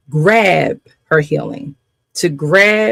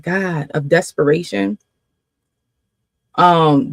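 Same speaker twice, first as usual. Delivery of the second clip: normal talking voice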